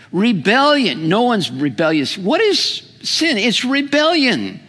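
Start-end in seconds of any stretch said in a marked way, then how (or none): 2.2-3.4 rhetorical question. Acted.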